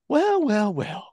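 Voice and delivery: high opening, falling mock gotcha; knowing accusation. Co-host imitating friend wanting wife to admit it, accusing. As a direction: imitate someone catching out a culprit. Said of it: "wanting her to admit it".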